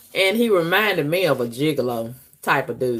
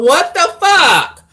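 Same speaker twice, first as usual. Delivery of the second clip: exasperated outburst; 10 semitones higher, 5 dB louder, slower than usual. Real, not acted. Venting about him not calling.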